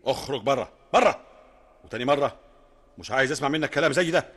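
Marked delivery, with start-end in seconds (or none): 0.9-1.3 furious high yell; angry shout. Acted. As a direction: bark it, throwing him out. Colonel throws suitor out.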